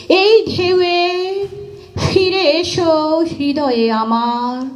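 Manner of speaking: slow, high poetic plea with drawn-out vowels; pained lover's recitation. Acted. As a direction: call out to a beloved in sustained, pleading verse. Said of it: "দেখে তার তখন খুব কষ্ট হয়". (ব্যথিত প্রেমিকের টানা সুরে কবিতা আবৃত্তি)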